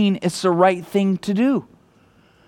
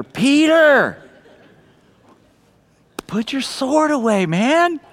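higher, patient scolding; gentle rebuke; 7 semitones higher, slower than usual. Retold or imitated. A preacher voices Jesus calling Peter to put the sword away, patient and higher. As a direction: call his name like a tired teacher.

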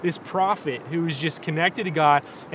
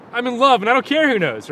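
higher, louder giddy declaration; Elf movie character; 6 semitones higher, 7 dB louder, faster than usual. Acted. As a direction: proclaim being in love, loud and high. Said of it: "that movie Elf, where he's like".

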